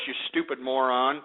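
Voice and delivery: normal talking voice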